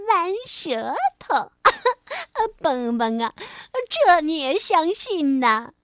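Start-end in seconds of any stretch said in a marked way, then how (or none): 1.6-2.5 high bursting laugh. Acted. Frog cracking up over the tongue story, high laugh. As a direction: crack up at the joke.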